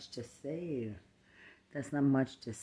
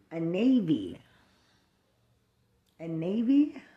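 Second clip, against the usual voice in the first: sarcastic mockery; 5 dB louder than usual. Real, not acted. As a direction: mimic her bragging with scorn. Repeated mocking.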